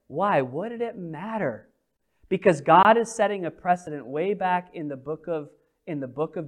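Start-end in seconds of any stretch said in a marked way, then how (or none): none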